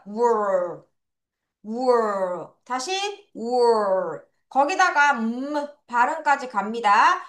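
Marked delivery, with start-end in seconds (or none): 0.1-4.2 Were as one low "w-er" syllable; blended in one go. Shown as a demonstration. Teacher drills learners on W, Were said in one blended sound. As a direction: glide from w straight into "er" in Were. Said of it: "요를 한 번에 발음할게요".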